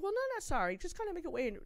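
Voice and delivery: squeaky embarrassed correction; mock sheepish voice. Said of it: "I'm like".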